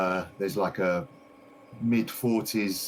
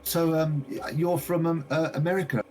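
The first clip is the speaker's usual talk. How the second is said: higher, eager shopkeeper voice; gushing shopkeeper lady; 7 semitones higher than usual. Acted. A game master voicing the shopkeeper greeting a visitor, high and eager. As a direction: play the delighted lady greeting her customer. Shopkeeper asks about America.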